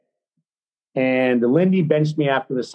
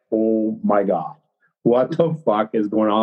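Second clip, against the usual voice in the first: low, slow disbelief; slow, low-pitched retold astonishment; stunned teammate; 5 semitones lower than usual. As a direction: imitate an onlooker aghast under his breath. Stunned teammate watching the fight.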